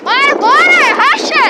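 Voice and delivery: high, loud cheering shout; hype friend cheering. Real, not acted. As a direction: cheer him on at full voice. Friends cheering a bike race. (grito alto de torcida)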